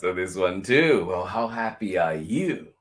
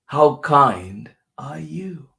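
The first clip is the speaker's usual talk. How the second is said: louder, slow, stress on how; slow emphatic question; 4 semitones higher, 8 dB louder, slower than usual. Pretend. Host calling the kindness question out to children, emphatic. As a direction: announce the question big and slow. Same question, repeated in turns.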